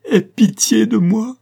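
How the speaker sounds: very high, loud, drawn-out wail of pleading; tearful cry. A narrator's reading. Daughter at her father's feet, desperate cry for pity. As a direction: cry out for mercy at full pitch. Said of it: "s'écria-t-elle".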